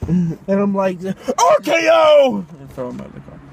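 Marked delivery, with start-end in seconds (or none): 1.0-2.4 high, loud, long drawn-out yell; hammed-up stretched war cry; wrestling move shout. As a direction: bellow it like a finishing move. Acted wrestling move call.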